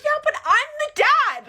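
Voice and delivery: very high, loud and drawn out; acted-out furious retort; indignant shout. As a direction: yell it as the outraged comeback. Acted quarrel, man's heated comeback.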